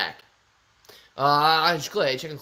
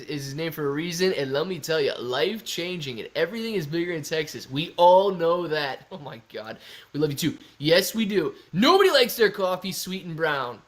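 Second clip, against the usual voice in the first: usual speaking voice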